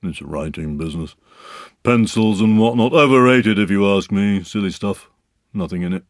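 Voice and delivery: dismissive donkey grumble; grumbling to himself. A narrator's reading. Eeyore alone scorning the pencils, grumbling. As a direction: grumble scornfully about the gift. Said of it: "Eeyore was saying to himself".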